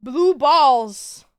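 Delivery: high, slow, stretched-out call; drawn-out loud call. Pretend. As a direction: call it out slowly and loudly.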